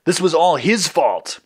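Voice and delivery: high, drawn-out accusation; protesting. A narrator's reading. Red blaming Green to the computer, high protest. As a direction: protest slowly, pitching the blame up. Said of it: "Red protested".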